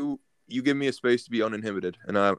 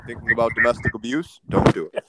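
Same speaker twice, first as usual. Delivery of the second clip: mock ad read; 5 dB louder than usual. Fake sponsor read.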